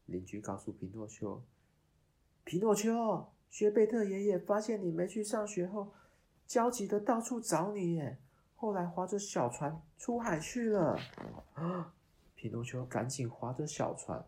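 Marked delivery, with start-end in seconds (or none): 2.4-11.0 higher, louder anxious neighbor; urgent neighbor voice. Acted. 11.0-11.9 shocked gasp. Acted. Shocked at the news.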